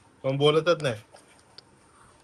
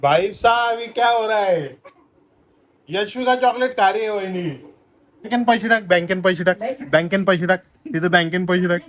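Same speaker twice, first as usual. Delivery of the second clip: excited; 5 semitones higher, 7 dB louder than usual. Real, not acted. Laughing at niece's antics.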